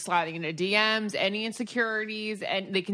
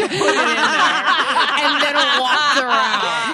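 laughing; 5 semitones higher, 8 dB louder than usual. Retold or imitated. Laughing retelling guy's odd act.

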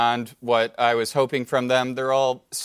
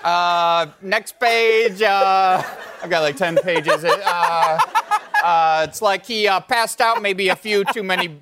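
folksy audiobook narrator; 5 semitones higher than usual. Acted. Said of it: "it would go like this".

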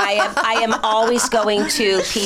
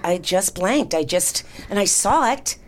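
ordinary talk

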